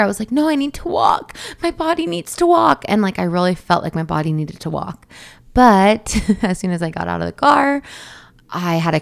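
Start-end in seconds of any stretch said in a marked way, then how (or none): 0.3-2.8 high-pitched, insistent retold protest; adamant. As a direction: act out digging your heels in. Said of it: "adamant about walking".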